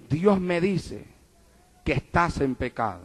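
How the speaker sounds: hushed, secretive telling; soft confidential aside; whispered into his ear. Retold or imitated. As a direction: murmur it close to his ear. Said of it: "yo le hablé al oído".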